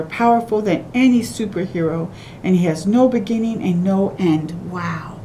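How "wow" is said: ordinary delivery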